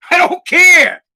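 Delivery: high, loud, fed-up yell; exasperated shout. Real, not acted. Fed-up dad ranting after a bad day, exasperated shout. Exasperated outburst in angry rant.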